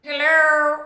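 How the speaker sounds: funny gangster voice. Shown as a demonstration. Answering her sister's catchphrase in a loud gangster voice. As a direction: echo it big in the silly family voice. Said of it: "talk in that gangster voice".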